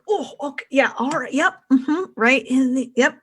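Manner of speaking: lightly acted villain taking hits; Barnum. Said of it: "Barnum's just like".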